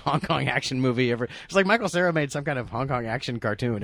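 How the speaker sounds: ordinary delivery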